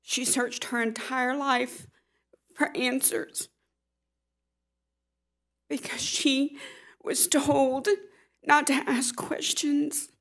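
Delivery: fighting tears, slow and soft; holding back tears. Real, not acted. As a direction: voice breaking, high and soft. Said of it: "I'm gonna try not to cry".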